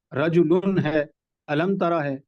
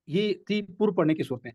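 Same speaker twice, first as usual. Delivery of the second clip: ordinary talk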